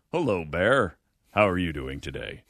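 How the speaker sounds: low, calm, mock polite greeting; Matt Butler voice. Facing a bear, calmly greeting it in a mock polite voice. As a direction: greet the bear like a friendly radio host. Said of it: "put on your best Matt Butler voice".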